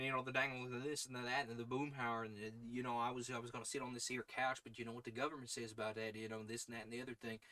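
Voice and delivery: fast, mumbling Boomhauer rattle; fast mumbled Texan. Retold or imitated. Imitating the King of the Hill guy, fast mumble. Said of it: "the dude from The King of the Hill".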